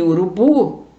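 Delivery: urubu slowly, with a long stressed BU; stress on last syllable. Shown as a demonstration. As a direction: stretch the final BU of urubu. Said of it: "o bu é a sílaba tônica".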